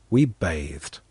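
usual speaking voice

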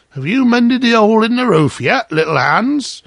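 loud, high, cheery miller; cheery call. A narrator's reading. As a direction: call out heartily to someone up a ladder. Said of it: "cried the miller in a cheery voice".

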